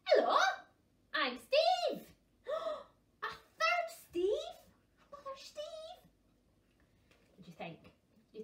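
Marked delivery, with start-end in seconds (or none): 0.1-2.0 high, loud cheerful greeting; new puffin. Acted. Third puffin introducing himself, loud and cheery. 2.5-6.0 very high astonished squeak; shocked gasp. Acted. Puffins shocked by a third Steve, gasping.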